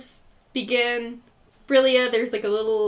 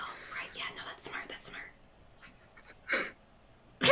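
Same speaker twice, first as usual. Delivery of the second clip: very soft whispered agreement; whispering; 17 dB quieter, faster than usual. Acted. Companion agreeing with the announcer's plan, whispering. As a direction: concede it under your breath, all whisper. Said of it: "are you whispering?".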